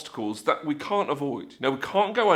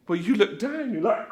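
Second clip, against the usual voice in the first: normal talking voice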